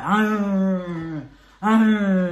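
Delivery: loud, long open ah sounds, retold; meditative labour moan. Mother demonstrating her birth sounds to husband, loud meditative moans. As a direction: show the sound she made in labour. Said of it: "this was the sound I was making".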